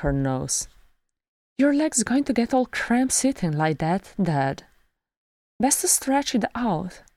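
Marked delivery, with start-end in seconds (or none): 1.6-7.0 put-on high-pitched young daughter voice; daughter to her dad.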